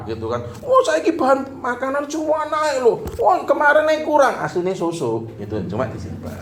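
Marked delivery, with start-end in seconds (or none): 0.6-5.3 very high put-on wife voice; hammed-up wife protesting; mock excuse. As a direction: plead that prices went up, pitched way up. Wife excuses in acted quarrel.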